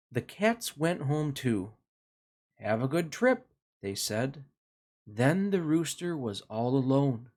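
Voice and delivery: put-on gentle storybook narration for kids; reading to children. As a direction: read it like a bedtime picture book. Reads picture book to children.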